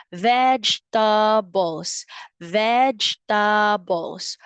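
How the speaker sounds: teacher slowing down "Vegetables" for the class; slow modeled word. Shown as a demonstration. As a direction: draw out "Vegetables" so kids hear the V. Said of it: "Where can we put vegetables?".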